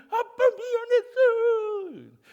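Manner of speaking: high, slow tearful apology; crying. Retold or imitated. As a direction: sob out the apology to a lost father. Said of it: "그때 우는 거예요". (돌아가신 아빠에게 우는 높은 목소리)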